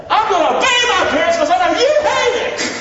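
high, loud defiant shout. Acted. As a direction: yell it right at the devil. Kids defying the devil.

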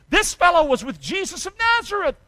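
put-on high, loud accusing servant girl; accusing maid. Preacher voicing a maid accusing Peter, high and loud. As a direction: point the finger, pitched high.

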